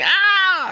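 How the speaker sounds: high, loud hammed-up scream of pain; mock scream. Voicing Luffy in the background screaming in pain. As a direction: yell it like a kid in pain. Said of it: "He's screaming".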